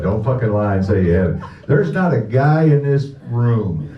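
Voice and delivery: normal talking voice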